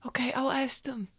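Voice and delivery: soft, breath-only answer; whispered reply. Acted. Adventurer agreeing to the child's request, whispered. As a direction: whisper back discreetly.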